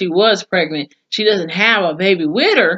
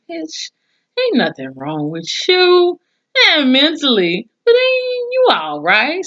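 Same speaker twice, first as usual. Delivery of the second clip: higher, scornful telling-off, a touch hammed-up; mock scolding; 10 semitones higher than usual. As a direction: call out her fake tears with sass. Scolding crying character on TV.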